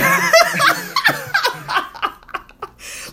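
very high, loud burst of laughter; a real squealing laugh; high-pitched cackle; shrieking laugh. Real, not acted.

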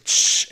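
imitated short aerosol burst; loud quick "shh" of a spray can; spray paint hiss. Storyteller imitating the neighbour spray painting, sharp hiss. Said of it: "He's spray painting".

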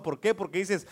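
ordinary delivery

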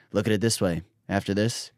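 low, hushed cop into your ear; confidential murmur. Retold or imitated. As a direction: imitate a cop's private aside, soft and low. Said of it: "the cop whispers in my ear".

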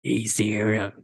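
creepy killer calling on the phone; Ghostface voice. Acted. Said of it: "Should I try doing the Ghostface voice?".

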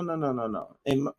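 ordinary talk, nothing marked

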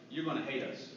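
low, soft admission; retold dread, said quietly; somber confession. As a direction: confess it heavily.

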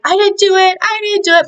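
put-on high, frantic little boy pleading; panicked kid denial. Little Rudiger to the sheriff, high panicked denial. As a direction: squeak it out like a scared kid accused. Boy denies pushing bully.

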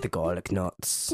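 low, dropped chef voice; deep chef voice. Acted. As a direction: play a gruff chef deep down. Chef Tony character line.

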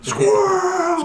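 high shrieked "Squirrel"; shout. Retold or imitated. Shouting movie line.

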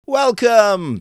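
high, loud call of welcome; cried welcome. Acted. Fish courtiers greet Urashima, high loud cry. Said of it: "they all cried".

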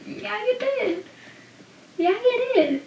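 squeaky high, sarcastic yeah you did; mock him high and soft; sarcastic high retort. Real, not acted. Talking back at Dawson, high and sarcastic. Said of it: "sarcastic retort".

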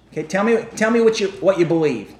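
normal talking voice

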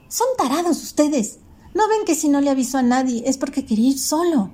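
put-on high girl's voice scolding classmates; a bossy girl telling off the others. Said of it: "dijo Vera".

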